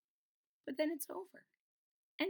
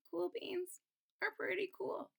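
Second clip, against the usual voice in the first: usual speaking voice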